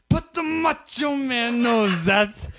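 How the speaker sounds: drawn-out, high, loud wrestler boast; Macho Man. Acted. Friend climaxing his tiger interview, loud high wrestler impression. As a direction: build to a slow wrestler shout. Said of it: "He built it up there at the end".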